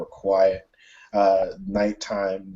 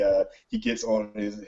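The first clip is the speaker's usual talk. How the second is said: normal talking voice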